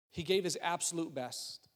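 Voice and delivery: ordinary talk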